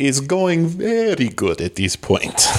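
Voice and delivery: put-on slow, drawn-out foreign accent; Cousin Balky. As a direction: stretch it out in a comic accent. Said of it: "Cousin Balky".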